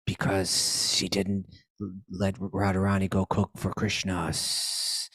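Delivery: slow snake voice with long hisses; hissing snake. Retold or imitated. Snake tells Krishna why it bit, slow hissing voice. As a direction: draw out the words and hiss long between them.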